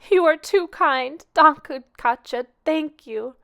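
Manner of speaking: high, loud, slow tearful gratitude; tearful thanks. A narrator's reading. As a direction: thank her through tears, overwhelmed. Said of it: "sobbed Missus Deland".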